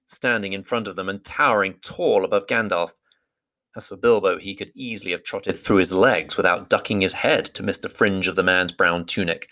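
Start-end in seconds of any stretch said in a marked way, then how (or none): none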